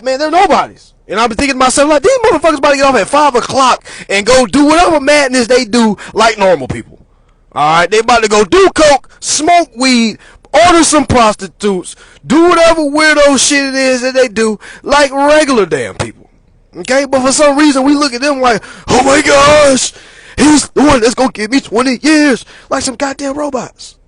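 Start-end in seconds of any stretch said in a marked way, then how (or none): 0.0-16.3 loud, heated ranting. Real, not acted. Riled-up rant about judges. 18.9-22.4 mock scared defendant.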